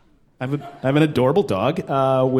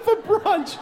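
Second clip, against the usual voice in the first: much higher, slow sing-song; a very high, slow affected mother; posh mom; slower than usual. Retold or imitated. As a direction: sing it like a precious Brooklyn mom. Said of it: "That's what the woman said".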